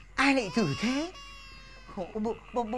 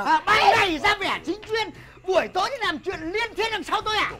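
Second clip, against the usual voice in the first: furious shout; 8 semitones higher, 6 dB louder than usual. Acted. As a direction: bellow the insult at the wife. Husband shouting accusation. (chồng quát to lăng mạ vợ)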